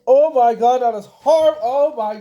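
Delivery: gagging, loud and high disgust; eating gross jelly beans. Real, not acted. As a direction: choke it out in disgust. Just ate a gross jelly bean.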